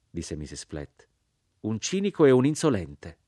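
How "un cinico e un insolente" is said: scornful accusation. Acted. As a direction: condemn him with disdain. Mrs Flett accuses the narrator.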